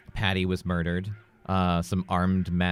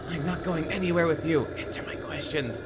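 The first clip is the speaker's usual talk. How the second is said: high-pitched, agitated refusal; frantic heroine; Rachel's defiant voice; 10 semitones higher than usual. Acted. Voicing Rachel refusing Mac.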